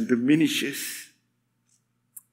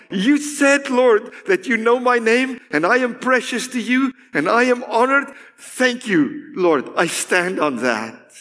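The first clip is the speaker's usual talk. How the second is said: higher, insistent declaration to the Lord; recited prayer; 6 semitones higher than usual. Acted. Believer reciting God's promises one by one, insistent. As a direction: pray the promises out with lifted pitch.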